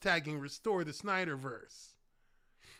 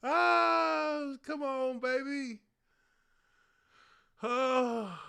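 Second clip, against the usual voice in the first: high, loud hype cheer; excited; 9 semitones higher, 5 dB louder, much slower than usual. Real, not acted. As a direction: cheer it up high like your team scored. Hyped at the counter.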